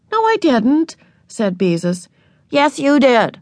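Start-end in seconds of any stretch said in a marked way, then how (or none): 0.1-1.0 higher-pitched defensive denial. A narrator's reading. Older sister denies skipping to her little sister, defensive. 2.5-3.4 put-on higher, insistent little-kid contradiction. A narrator's reading. Little sister contradicts her older sister, insistent. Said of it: "insisted Ramona".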